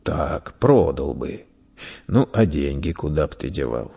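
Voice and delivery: put-on low echo of the boy's answer; deep-pitched, measured interrogator; questioning merchant. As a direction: weigh it up, then probe further. Merchant echoes, probes the boy.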